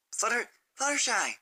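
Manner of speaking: high call across the street. Acted. Sunset calling after Fluttershy down the road, high call. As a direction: call after her to stop her. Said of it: "she called out".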